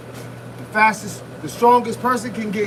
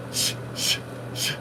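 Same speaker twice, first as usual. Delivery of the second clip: whispered shh on each punch; hissing exhale; 10 dB quieter than usual. Retold or imitated. Imitating silent fighters for viewers, soft hissing shh breaths. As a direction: breathe out through the teeth, silent. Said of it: "you hear 'em going".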